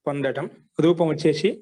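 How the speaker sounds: normal talking voice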